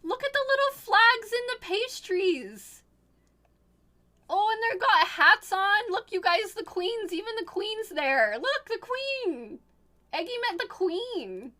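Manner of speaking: high, excited gushing; giddy delight. Real, not acted. Streamer showing viewers Eggie with the queen, giddy delight. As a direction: point out each detail with squealing glee. Excitedly pointing out picture details.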